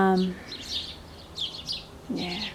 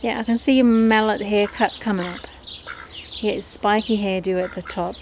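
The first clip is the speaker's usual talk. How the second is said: normal talking voice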